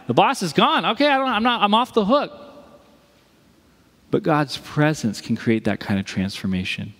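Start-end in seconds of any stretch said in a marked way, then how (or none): 0.1-2.3 high, lively acted excuse; carefree relief. Acting someone freed from the boss, relieved. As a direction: blurt it out as if let off.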